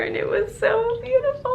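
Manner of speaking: high, choked-up crying voice; tearful, voice breaking. Real, not acted. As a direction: let the tears break through the words. Recalling the couple's moment, tearing up.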